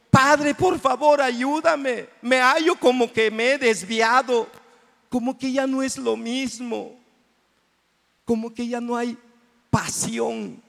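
high, pleading prayer to the Father. Acted. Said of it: "le decimos".

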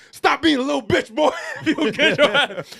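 high, loud mock scolding rat master; scolding Splinter. Splinter scolding Michelangelo in a hammed-up loud voice. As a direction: bark it down at him like a fed-up old sensei. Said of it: "Master Splinter, his father, is basically like".

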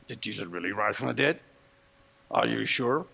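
a sceptic's hushed questions to the congregation; doubting voice. Acted. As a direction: let the doubt creep in low. Voicing a doubter's inner questions.

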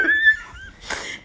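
very high, shrieking laugh; high burst of laughter. Real, not acted. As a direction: let a real laugh burst out. Laughs after shocked reply.

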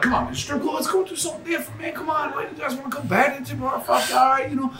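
high, soft mock coaxing; mimicked pleading. Mimics pleading over strip club choice.

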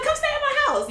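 very high, bright invitation; eager invite. Retold or imitated. Kamara inviting her to stay, very high and eager. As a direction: gush the offer. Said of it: "She's like".

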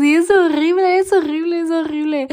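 high, loud cry of horrible, fading to a sob; whiny despair. Real, not acted. Vlogger lamenting to listeners how cold hurts, high whiny wail. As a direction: wail it three times, breaking at the end. Repeating her misery about cold.

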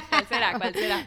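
loud, laughing tease; teasing laugh. Real, not acted. Curious about her friend's zen secret, laughing.

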